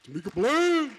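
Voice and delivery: loud, high call of the name; excited shout. Real, not acted. Host hyping up Tamika as she comes up, with a loud high call. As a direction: shout her name out in celebration.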